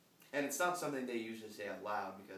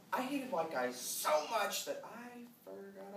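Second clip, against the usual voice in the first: overwrought confession; 9 semitones higher, slower than usual. Acted. Confessing exaggerated college-era hatred.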